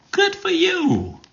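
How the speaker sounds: very high, drawn-out cheer; sing-song it way up high; slow, high-pitched congratulation; different approach. Shown as a demonstration. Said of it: "different linguistic approach".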